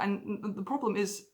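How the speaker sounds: usual speaking voice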